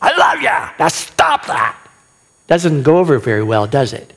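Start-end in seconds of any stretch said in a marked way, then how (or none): 0.0-0.7 much higher, harsh parental yell; mock yelling. Shown as a demonstration. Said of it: "You don't yell at her". 0.8-1.8 shouted order to quit; yelled command. Shown as a demonstration. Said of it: "You don't yell at her and just say".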